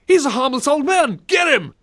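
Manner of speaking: high-pitched play-acted cry; rally the chase in a silly high voice; mock pursuer. Acting out crowd chasing old man.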